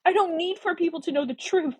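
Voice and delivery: high, impassioned interruption; urgent emotional insistence; jumping in. A narrator's reading. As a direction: override his protest with feeling. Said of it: "jumping on top of his ill-timed protest".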